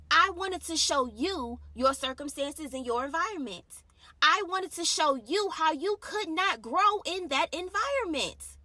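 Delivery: preaching. Real, not acted. Preaching at full force.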